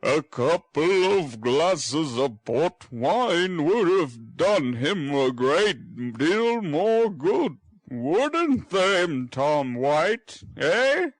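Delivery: put-on teasing the boy; jovial old gentleman. Brownlow jovially calls the boy by a wrong name.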